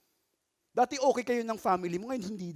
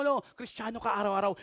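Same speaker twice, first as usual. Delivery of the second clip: usual speaking voice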